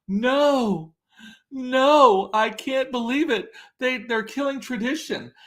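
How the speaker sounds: high-pitched, hammed-up dismay; mock outrage. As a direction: wail it like scandalized cookie fans. Mimics upset people's outcry.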